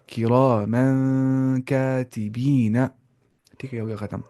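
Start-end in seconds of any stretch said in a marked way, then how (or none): 0.1-2.8 slow "کرامن کاتبین", noon hummed before "کاتبین"; nasal ikhfa. Shown as a demonstration. Teacher recites the whole word, slow nasal ikhfa. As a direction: draw out each long vowel, hide the noon in the nose. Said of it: "نون کی آواز کو ناک میں چھپانا ہے".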